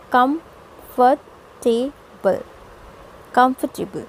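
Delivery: कम्फर्टेबल broken into four parts, kum-fer-tay-bul; कम्फर्टेबल the common Indian way, every syllable sounded; four-syllable mispronunciation. Shown as a demonstration. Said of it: "हम इसे four syllables में कहते हैं".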